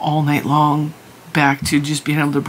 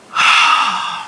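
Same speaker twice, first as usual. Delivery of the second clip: long, breathy sigh of pure exhaustion; heavy sigh. Real, not acted. Up all night with sick puppies, a heavy tired sigh. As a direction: let all the air out, worn out.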